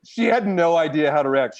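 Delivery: ordinary talk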